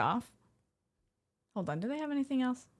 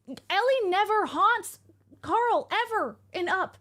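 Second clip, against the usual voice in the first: slow, loud and higher, leaning on every word; emphatic insisting; 8 semitones higher, 4 dB louder, slower than usual. Real, not acted. Streamer correcting chat about Up, slow and emphatic. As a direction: hammer the point home word by word.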